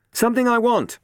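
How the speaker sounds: high, loud call back over the shoulder; shouting girl. A narrator's reading. Robin shouts to Matt as she runs off, loud. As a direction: yell it while running off. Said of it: "she shouted".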